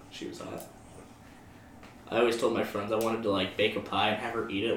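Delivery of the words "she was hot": breathless whisper, no voice at all; whispered aside. Real, not acted. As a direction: drop to a secret whisper. Aside about the foods teacher.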